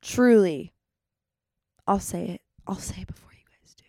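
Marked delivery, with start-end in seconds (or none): 1.9-3.8 unvoiced soft whisper. Real, not acted. Admitting love to podcast listeners before they do, whispering. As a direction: confide it with a wink.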